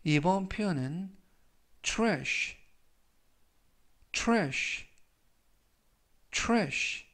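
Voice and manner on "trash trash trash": trash said clearly, three times; teacher modelling trash; model pronunciation. Shown as a demonstration. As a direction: repeat trash carefully for learners. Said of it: "라고 발음하시면 되겠고요".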